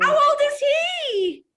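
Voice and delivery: very high, loud, stretched question; real delighted cooing. Real, not acted. Guest asking about colleague's boy, excited high coo.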